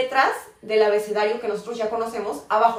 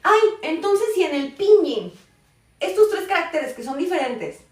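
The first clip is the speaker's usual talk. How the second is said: lightly voiced student objection, pitched up; mock a learner's puzzled protest, higher; imagined puzzled students; 6 semitones higher, 3 dB louder than usual. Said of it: "van a decir". (imitando levemente la queja de un alumno confundido)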